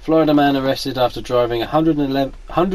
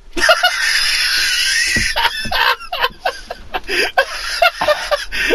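big burst of laughing. Real, not acted. Laughter after urine line.

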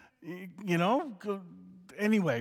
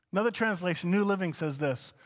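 normal talking voice

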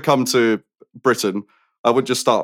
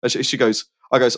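normal talking voice

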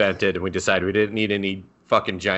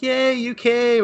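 much higher, slow, delighted squeal; gleeful squeal; 3 dB louder, slower than usual. Retold or imitated. Girl cheerfully greeting her fake boyfriend, high and gleeful. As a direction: greet him like a thrilled kid.

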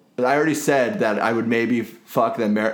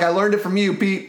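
mock dog voice; 8 semitones higher than usual. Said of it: "He's like".

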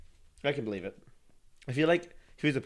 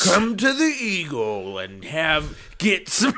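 slow, shouted announcer hype; drag brunch ad; 4 semitones higher, slower than usual. Acted. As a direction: drag it out like a radio promo.

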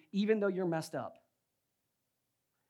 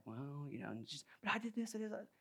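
hushed, much softer mimicked excuse; meek imitation; 3 semitones lower, 12 dB quieter than usual. Wife defends herself to angry husband, meek imitation. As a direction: imitate a small-voiced protest.

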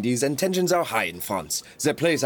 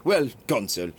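normal talking voice

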